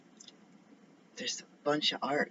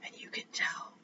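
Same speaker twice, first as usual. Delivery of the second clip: soft unvoiced whisper; whispered aside; 5 dB quieter than usual. Real, not acted. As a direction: keep it hushed and secretive. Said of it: "Why am I whispering?".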